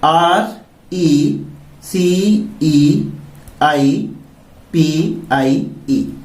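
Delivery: slow, letter by letter; teacher spelling a word aloud. Shown as a demonstration. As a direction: spell each letter slowly and clearly. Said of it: "इस spelling को complete करते हैं".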